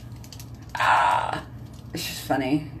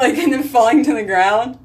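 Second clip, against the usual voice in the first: high, laughing through the words; giggling at the mental image; laughing while talking; 7 semitones higher, 5 dB louder than usual. Real, not acted. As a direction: crack up as you picture it.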